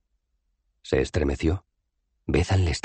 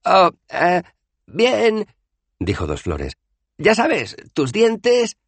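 titubeo nervioso, mucho más agudo y fuerte; Dos Flores titubeante; 5 dB louder, much slower than usual. Acted. (titubeo nervioso muy agudo)

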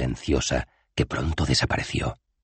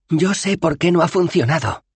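put-on high, young woman's voice, louder; Bethan's voice; 5 dB louder than usual. Bethan calling out from the crowd, high and sure. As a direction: call out confidently from the back.

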